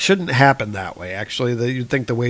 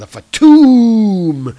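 louder, higher, slow comic-book crash noise; drawn-out mock impact sound; crash sound effect; 8 semitones higher, 9 dB louder, much slower than usual. Car smashed by fist.

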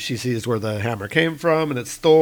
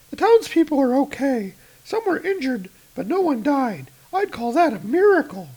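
much higher relieved report; relieved woman; 14 semitones higher than usual. Acted. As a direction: speak high and hopeful. Roz voiced.

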